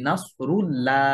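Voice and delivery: "নাসরুল্লাহ" with a thin, light "lla"; wrong thin lam. Shown as a demonstration. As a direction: say "নাসরুল্লাহ" wrongly, ending in a flattened "laa" instead of "law". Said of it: "পাতলা করে বলি". ("নাসরুল্লাহ"-এর লাম ভুলভাবে পাতলা করে)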